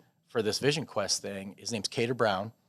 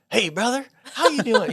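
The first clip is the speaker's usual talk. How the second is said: a cheerful, upbeat Southern hello; super positive Southern greeting; 11 semitones higher, 7 dB louder than usual. Retold or imitated. Author on the phone, greeting him in a cheery Southern voice. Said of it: "super positive, super Southern".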